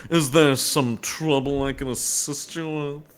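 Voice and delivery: slow, drawn-out caricature voice; put-on background character. Voicing a drawn uniformed filler guy offering help, slow caricature. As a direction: stretch the offer out in a silly put-on voice. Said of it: "the drawing of this guy going".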